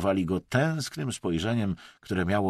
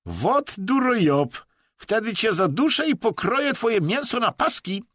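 the Chinese man promising gruesome revenge, high and loud; menacing threat; 13 semitones higher, 5 dB louder than usual. A narrator's reading. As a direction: threaten him in a shrill put-on voice. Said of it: "obiecał mu Chińczyk".

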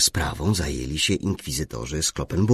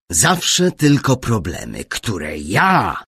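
„ja” mocno podbite i głośne; nacisk na „ja”; 3 semitones higher, 6 dB louder, slower than usual. A narrator's reading. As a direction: punch the last word „ja” hard. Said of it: "mocno zaakcentował ostatnie słowo". (mocny nacisk na ostatnie „ja”)

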